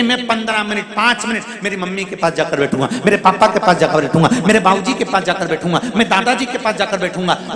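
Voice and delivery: fast list. Shown as a demonstration. Listing resolutions rapidly.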